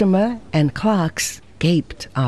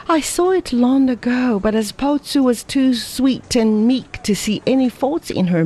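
put-on higher, brisk young woman scolding; Ada's voice lecturing; 7 semitones higher than usual. A narrator's reading. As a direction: lecture him, lightly voiced and reproachful. Ada lectures Lin Fo.

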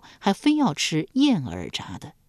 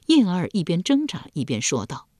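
ordinary delivery, nothing marked

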